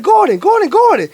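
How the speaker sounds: high, eager retold calling; excited high-pitched holler; yelled out. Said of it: "I literally yelled out".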